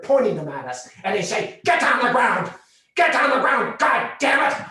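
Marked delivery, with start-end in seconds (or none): 1.6-4.7 higher, louder barked command; cops' shouted order. Retold or imitated. Cop yelling at two teens to get down, high and loud. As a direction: yell it like a cop with a gun drawn.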